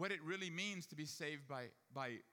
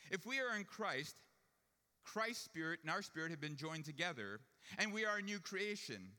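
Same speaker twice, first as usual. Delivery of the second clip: raised, louder pulpit voice; fervent preaching; 4 semitones higher, 4 dB louder than usual. Acted. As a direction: proclaim it with full conviction. Preaches at full force.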